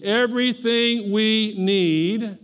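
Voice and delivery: slow and drawn out, leading the congregation; slow unison call-out. Shown as a demonstration. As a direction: stretch "Everything" so they can say it with you. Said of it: "Everybody say it with me".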